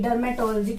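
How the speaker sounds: Dermatology as derma-TOL-jee; corrected pronunciation. Shown as a demonstration. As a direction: join the L to "to" in Dermatology, then jee. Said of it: "क्या कहते हैं?". (Dermatology को डर्मेटोल-जी बोलकर)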